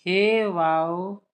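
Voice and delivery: हे वाओ drawn out, each letter name held; name the letters हे वाओ slowly; letters sounded out slowly. Shown as a demonstration. Teacher slowly names letters हे वाओ while writing. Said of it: "देखिए".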